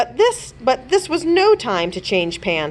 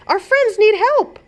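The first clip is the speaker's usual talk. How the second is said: higher, urgent plea; a bit louder, pressing for action; urgent princess; 14 semitones higher, 4 dB louder than usual. A narrator's reading. Princess tells her wolf friends need help, urgent and high. Princess urging over sparkle signal.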